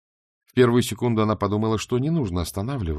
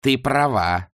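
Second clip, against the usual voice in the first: gloating; 3 semitones higher, 4 dB louder, much slower than usual. Acted. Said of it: "злорадно сказала Шаяна".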